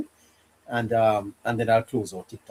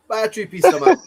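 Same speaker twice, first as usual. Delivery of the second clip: laughing through the words; 8 semitones higher, 7 dB louder than usual. Real, not acted. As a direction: chuckle while teasing. Laughing joke about three piece.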